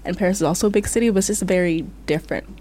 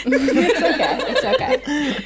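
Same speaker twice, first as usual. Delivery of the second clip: laughing; 7 semitones higher, 5 dB louder, much slower than usual. Real, not acted.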